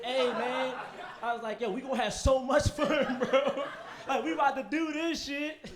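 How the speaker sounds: loud, high pumped-up bro talk with laughs; hyped-up bro. Retold or imitated. Greeting his skydiving instructor Trevor, all pumped up. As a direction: hype up the instructor. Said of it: "So I was like".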